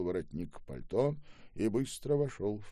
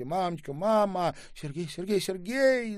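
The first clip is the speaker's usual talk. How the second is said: high, loud cries of recognition; overjoyed cries; 12 semitones higher, 8 dB louder than usual. A narrator's reading. Mother and missing son reunite at the door, overwhelmed cries. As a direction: cry out the name, climbing higher and louder. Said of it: "закричала женщина".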